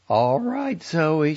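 put-on high, tired young woman conceding; Franny resigned. A narrator's reading. Franny answers the phone.

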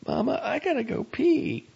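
put-on high, small child's voice; a young boy pleading to his mama; little boy voice. A narrator's reading. Little boy Ralphie speaks.

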